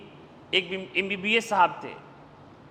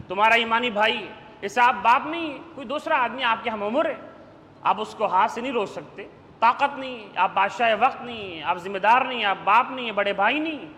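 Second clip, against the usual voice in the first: ordinary talk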